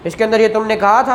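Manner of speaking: ordinary talk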